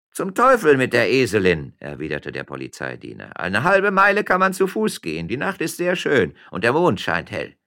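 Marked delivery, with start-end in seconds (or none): 0.1-1.7 loud, raised-pitch exasperation; irritated curse. Acted. Policeman rejects saddling the donkey, irritated. 3.4-7.6 high, loud official voice; insistent policeman. Acted. Policeman urges miller to walk, insistent. Policeman argues against the donkey.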